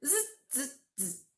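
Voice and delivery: high buzzy zzz; monkey chatter. A narrator's reading. Monkeys mocking the peddler, high buzzing chatter. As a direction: buzz it back like cheeky monkeys. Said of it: "shook both their hands back at him and said".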